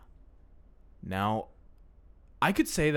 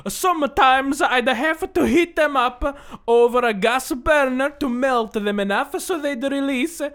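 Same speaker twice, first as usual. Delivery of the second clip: put-on slow, higher Italian accent, drawn out; little old Italian man; 8 semitones higher, 3 dB louder than usual. Reading the review aloud in a little old Italian man accent. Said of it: "You sound like Mark from Auntie Donna".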